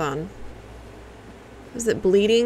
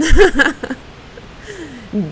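high burst of chuckles; real laugh tailing off; 4 semitones higher, 4 dB louder than usual. Real, not acted. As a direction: crack up at your own joke. Laughs at own impression.

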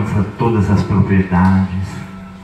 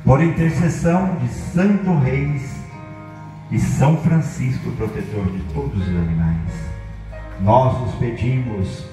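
prayer; 2 semitones higher, 4 dB quieter than usual. Acted. Leads a formal blessing prayer.

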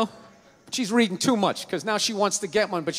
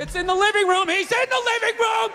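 mock panic; 9 semitones higher than usual. Couple hunting gun parts from intruder, mock-panicked shouting. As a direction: build to a high-pitched panicked yell. Acted frantic search during home intrusion.

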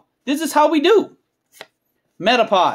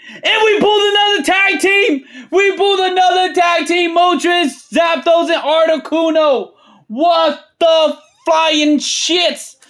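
high, hyped-up announcer shout; ecstatic shouting; 5 semitones higher than usual. Real, not acted. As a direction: holler the big pull, stretching the exclamations. Big card pull celebrated aloud.

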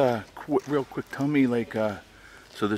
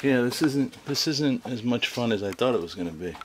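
higher-pitched mock complaint; imitating Laura; 4 semitones higher than usual. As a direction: voice a let-down companion, pitched up. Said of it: "She's like".

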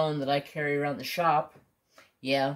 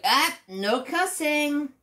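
high, slow teasing scold; drawn-out high mock telling-off; 12 semitones higher, 4 dB louder, slower than usual. Teasing the boss who just cursed, high and slow. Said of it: "I'm like".

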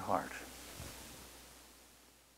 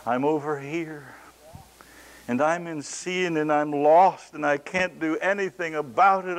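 desperate sinner's plea; 7 semitones higher, 8 dB louder than usual. Acted. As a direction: pray like a lost sinner begging, rising to a wail. Acted sinner's desperate prayer.

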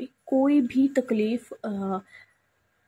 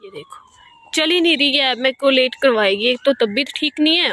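raised, higher exasperated voice; loud complaint; 4 semitones higher, 8 dB louder than usual. Real, not acted. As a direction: complain loudly over the delay.

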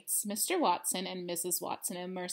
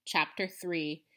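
ordinary delivery

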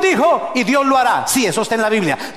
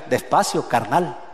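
Mexican accent; 7 semitones lower, 3 dB quieter, slower than usual. Shown as a demonstration. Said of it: "hacia el estilo mexicano".

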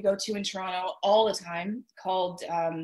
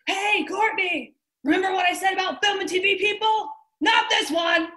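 high, loud scolding holler imitating a friend; full-voiced retold yell, much higher than usual; yelled up stairs; 12 semitones higher, 7 dB louder than usual. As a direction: mimic someone hollering a warning up the stairs. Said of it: "she yelled up the stairs".

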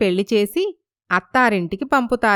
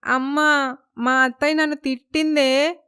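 higher-pitched mock-tearful whine; tearful complaint; 4 semitones higher than usual. Daughter tells mother about mother-in-law's scolding, tearful complaint. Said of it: "ఏంటే ఆ ఏడుపు?".

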